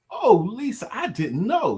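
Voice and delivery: high-pitched delighted exclamation; excited reading aloud. Acted. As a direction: read it out with a high swoop. Reads viewer's congratulatory comment aloud.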